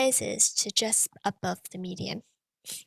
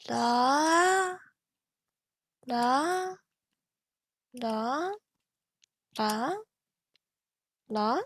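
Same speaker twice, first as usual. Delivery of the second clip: rising la, gradually quicker; tone sped up; much slower than usual. Shown as a demonstration. Teacher speeding up the tone for students to follow. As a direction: speed the tone up bit by bit. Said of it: "gradually speed up this pitch".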